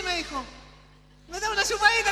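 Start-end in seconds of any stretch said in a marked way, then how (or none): 1.3-2.1 high, loud teasing request; cheeky punchline. Retold or imitated. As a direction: deliver the punchline playfully.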